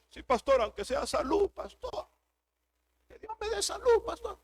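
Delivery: high whiny plea trailing off; hammed-up whimpering parishioner; mock pleading believer. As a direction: mimic a churchgoer begging for health. Pastor mimics pleading churchgoer.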